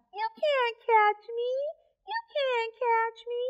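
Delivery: squeaky sing-song taunt; teasing monkey voice. Acted.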